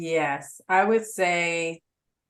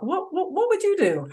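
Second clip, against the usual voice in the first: much higher, put-on friend's voice; friend asking advice; 12 semitones higher than usual. Imitating friends coming for financial advice, high questioning voice. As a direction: mimic a friend asking, pitched way up. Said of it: "ask me questions and, like, come to me".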